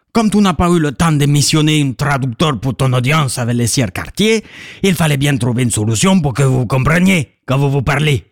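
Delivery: normal talking voice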